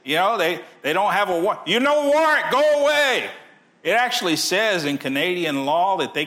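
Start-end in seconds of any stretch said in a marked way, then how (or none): 1.7-3.3 very high, loud mock Russian shout: go away; a hammed-up Russian pastor yelling Nazis away; high loud Russian-accented shouting at police. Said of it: "he had that Russian accent".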